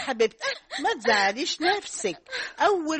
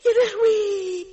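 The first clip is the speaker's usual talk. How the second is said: horrified cry; 6 semitones higher, slower than usual. Acted. Mother fears husband did it too, crying out. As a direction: wail the alarm.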